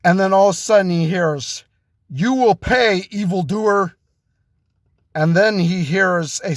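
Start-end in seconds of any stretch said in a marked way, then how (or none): 2.1-3.9 avenging hero. Acted. Avenger overheard threatening the gunman.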